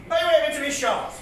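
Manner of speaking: projected comic-verse delivery; recited punchline. Acted. Comedian delivering the cowboy rhyme to the crowd, declaimed. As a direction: declaim it to the room. Recited line, then muttered repeat.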